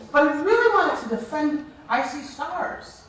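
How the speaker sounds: usual speaking voice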